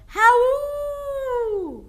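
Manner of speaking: high, loud falling howl; wolf howl. Shown as a demonstration. Teacher shows the class a wolf howl. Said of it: "wolves howl".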